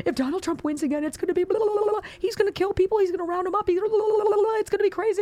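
high, mocking whine of doom; sing-song mock panic; mocking alarmist. Mocking Democrats' doom talk.

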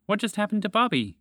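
high-pitched worried asking; alarmed question. A narrator's reading. Spaceship asks about its frozen friend, high and alarmed. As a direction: pitch it up with urgent concern.

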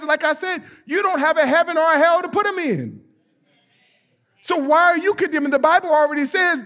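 high, loud preaching; preaching at full force. Acted. Pastor to congregation, high loud preaching. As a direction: preach it with rising fire.

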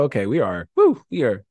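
usual speaking voice

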